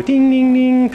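high, loud "ding, ding, ding"; high ding sound effect. Pretend. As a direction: imitate little cartoon dings popping up. Imitates a cartoon sound effect.

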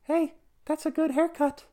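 very high, tiny squeaky voice; high little voice from nowhere. Acted. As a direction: squeak it out like something very small. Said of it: "he hears this little voice say".

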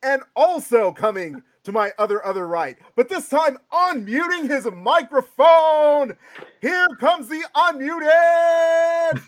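loud, high announcer intro with long-held final words; hyped ring announcer. Acted. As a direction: belt out the introductions and stretch the last word.

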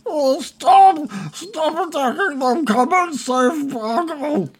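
high, wailing goblin calling off minions; hammed-up tearful squeal; blubbering. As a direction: cry out pleadingly, dragging the last words. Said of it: "he's blubbering".

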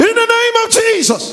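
shouted declaration. Real, not acted. Pastor sealing the prayer for his church, shouted high. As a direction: cry it out to seal the prayer. Climax of prayer, congregation answering.

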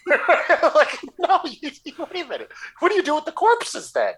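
high, laughing incredulous rant; laughing outrage. Real, not acted. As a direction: laugh through the disbelief.

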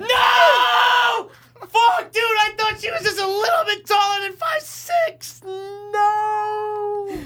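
high-pitched wail of defeat; loud, drawn-out howl of disbelief; anguished shout. Real, not acted. Guesser who just lost on the height, anguished shouting.

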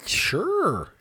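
drawn-out mock-eager. Real, not acted. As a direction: sing out each word high and long. Game answer to a question.

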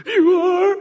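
put-on high, small child's answer; small boy. Son answering his dad's question in a high child voice. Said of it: "He goes".

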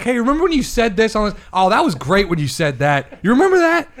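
loud, high mock-enthusiastic needling; taunting callout. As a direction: rub it in, rising to a high, loud final question. Gleefully acts out his tweeted jab.

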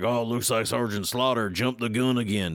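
lightly voiced drawling soldier; Beachhead voice. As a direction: toss it off like a weary squadmate. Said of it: "That's my beachhead voice".

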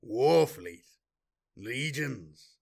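scornful, mocking echo; mocking edge. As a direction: throw his words back with scorn. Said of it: "with a mocking edge to his voice".

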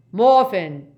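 sudden full-voiced call; loud shout. Father calling his son from the cottage, loud. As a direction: holler the name out loudly. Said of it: "said a loud voice".

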